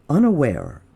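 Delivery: ordinary delivery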